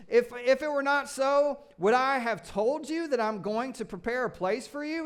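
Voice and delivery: high, loud, gleeful reading with cheers; excited cheering. Acted. Preacher to congregation, cheering over the promises. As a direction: cheer each promise like great news.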